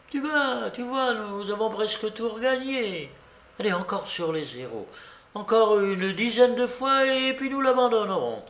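put-on high-pitched old lady, eager to bet; excited babouchka. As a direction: urge them on in an old lady's high voice. (vieille joueuse fébrile, voix aiguë)

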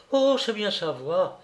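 high-pitched cry of recognition; woman witness. A narrator's reading.

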